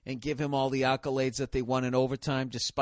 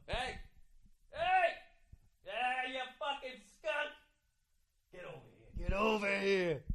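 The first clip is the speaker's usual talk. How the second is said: calling out at a skunk; 7 semitones higher, 8 dB quieter than usual. Retold or imitated. Teasing a skunk in the street.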